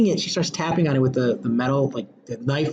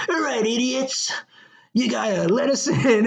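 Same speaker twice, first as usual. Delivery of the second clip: mock tough guy; 8 semitones higher than usual. Retelling kidnappers' demand scene.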